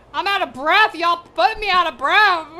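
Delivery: laughing shout of being out of breath; loud mock breathless exclaim. Real, not acted. Vlogger exclaims to viewers she is winded, loud mock breathless shout. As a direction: belt out that she's winded, half joking. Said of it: "I'm also out of breath".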